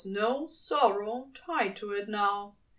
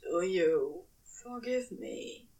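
low, hoarse and hopeful plea; 4 semitones lower, 4 dB quieter than usual. A narrator's reading. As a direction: ask it hoarsely, hoping. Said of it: "his voice hoarse with hope".

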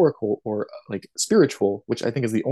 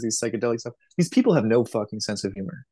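normal talking voice

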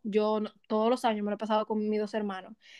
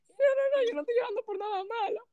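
high-pitched tearful protest, imitated; llorando; 12 semitones higher than usual. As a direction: mimic yourself insisting through sobs, pitch way up. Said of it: "[name], deja de llorar". (imitando una protesta llorosa, muy aguda)

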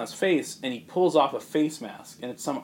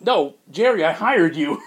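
indignant protest; 4 semitones higher, 6 dB louder than usual. Retold or imitated. Bruce protests to security chief.

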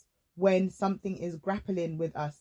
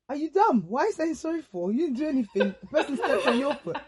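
scolding herself; 6 semitones higher than usual. Retold or imitated. Scolding herself for apologising to the pusher, high and laughing. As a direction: berate yourself in disbelief. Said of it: "I was like".

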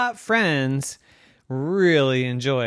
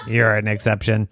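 low deadpan robot; 5 semitones lower than usual. Acted. Robot excusing Evan from boring people, monotone robot voice. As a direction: concede it in an even robotic drone.

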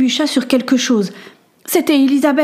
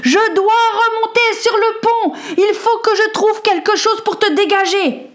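shouting over the storm; 11 semitones higher than usual. Acted. Boy shouts into his friend's ear over the storm, high and urgent. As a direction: yell it so she hears through the noise. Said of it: "Il lui cria à l'oreille".

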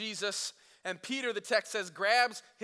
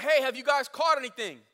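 louder, higher hail across the water; calling out; 5 semitones higher, 8 dB louder than usual. Retold or imitated. Stranger on shore hailing the fishermen, calling out loud. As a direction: shout it to a boat offshore. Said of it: "he calls out".

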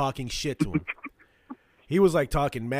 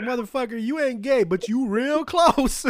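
mocking comedian; 9 semitones higher, 5 dB louder than usual. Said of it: "He's like".

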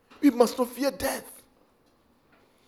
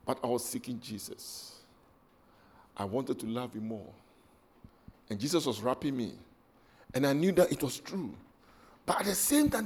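much softer and lower, intimate; hushed testimony of past temptation; quiet confession; 11 semitones lower, 10 dB quieter than usual. Real, not acted. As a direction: confide it like a private prayer.

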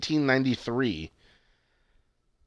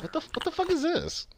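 baffled; 14 semitones higher, 6 dB quieter than usual. Retold or imitated. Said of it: "I was like".

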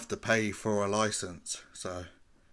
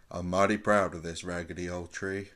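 [name]; 3 semitones lower than usual. Retold or imitated. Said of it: "yeah, it's [name]".

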